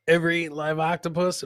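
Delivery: normal talking voice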